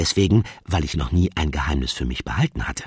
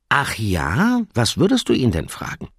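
amused undertone; 7 semitones higher, 4 dB louder than usual. Acted. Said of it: "fragte mit amüsiertem Unterton".

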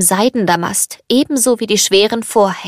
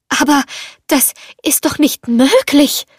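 higher, halting disbelief; astonished; 5 semitones higher than usual. A narrator's reading. Belle sees the furniture is alive, astonished. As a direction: pause after the first word, then marvel. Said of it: "staunte Belle".

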